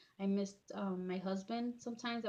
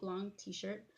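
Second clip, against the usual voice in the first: ordinary talk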